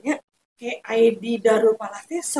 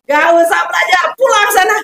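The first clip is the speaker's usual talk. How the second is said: put-on louder, brisk order to the kids; loud dismissal; 4 semitones higher, 10 dB louder than usual. Teacher sending her Quran pupils home, loud. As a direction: send the children home, loud.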